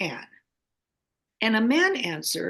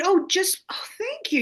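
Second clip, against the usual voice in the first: gushing thanks; 9 semitones higher than usual. Retold or imitated. Retelling thanks to the caller.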